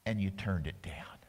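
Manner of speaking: hushed, sorrowful whisper; preacher mourning the lost chance, whispered. Real, not acted. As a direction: breathe it out, grieving. Sermon on Jesus weeping.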